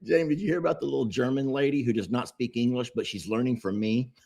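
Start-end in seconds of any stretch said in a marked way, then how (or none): none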